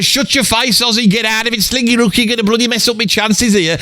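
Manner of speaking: high, lightly voiced irritated Ralph; imitating annoyed Ralph. Ralph telling Ozzy off mid-serenade, high mock voice. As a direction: shoo Ozzy off, mildly cross. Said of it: "he'd be going".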